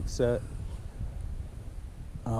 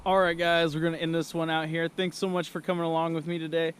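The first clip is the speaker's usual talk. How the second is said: higher, upbeat ad read; sponsor outro; 6 semitones higher than usual. Acted. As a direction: wrap up the vlog cheerfully.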